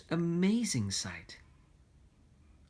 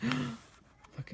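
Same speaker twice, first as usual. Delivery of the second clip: breathless whispered look; gasped whisper; 9 semitones higher, 6 dB quieter than usual. Real, not acted.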